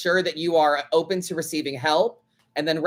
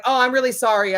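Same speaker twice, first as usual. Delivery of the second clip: mock apology; 5 semitones higher, 4 dB louder, faster than usual. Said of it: "she was like".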